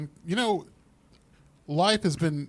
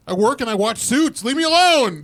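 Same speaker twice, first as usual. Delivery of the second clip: mock outburst; 6 semitones higher, 5 dB louder, slower than usual. Mock outburst about being left alone.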